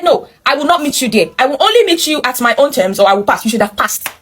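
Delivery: ordinary talk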